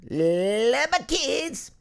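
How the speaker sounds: high, slow drawn-out cry; exuberant declaration. Acted. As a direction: stretch it out, climbing high with gusto. Same words, exaggerated loudly.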